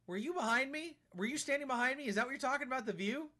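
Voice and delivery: high, accusing questions; mock interrogation. Real, not acted. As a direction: grill him like a suspicious parent. Interrogating a game character.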